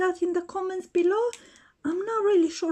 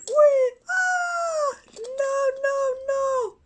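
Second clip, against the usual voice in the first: excited Pink Ranger; 8 semitones higher than usual. Pretend. Pink Ranger celebrating her kick, high squealing cheers. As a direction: cheer like you just won the fight.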